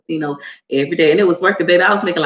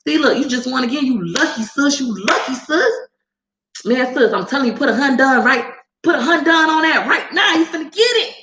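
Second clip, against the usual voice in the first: higher, hyped gambler voice; hammed-up high roller urging bigger bets; pushy big baller; 7 semitones higher than usual. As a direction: egg her on to bet bigger. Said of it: "It was like".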